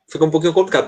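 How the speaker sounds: normal talking voice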